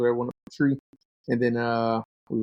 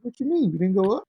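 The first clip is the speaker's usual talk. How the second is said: higher, mock-astonished buddy; a light impression of a stunned friend; buddy's disbelief; 9 semitones higher, 3 dB louder than usual. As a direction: pitch way up in disbelief. Buddy shocked in story.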